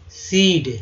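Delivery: normal talking voice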